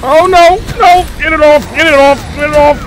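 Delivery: high shrieking panic, get it off; phobic panic. Real, not acted. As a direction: scream in terror as it grabs you. Monster attacks, screaming.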